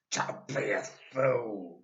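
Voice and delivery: put-on loud, slow, sneering old man; Mister Twit. A narrator's reading. Nasty old husband teasing his wife, loud and slow. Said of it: "Mister Twit said".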